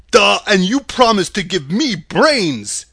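loud, goofy straw-man voice; dim Scarecrow. A narrator's reading. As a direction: blurt it out as a lovable dimwit.

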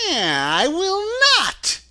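high, loud, drawn-out witch voice; wicked witch flatly refusing; slow, high-pitched defiant crone; retorting witch. A narrator's reading. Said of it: "retorted the witch".

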